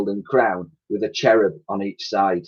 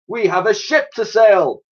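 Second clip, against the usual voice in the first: commanding; 10 semitones higher than usual. A narrator's reading. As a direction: bark it out like a proud old admiral. Admiral continues.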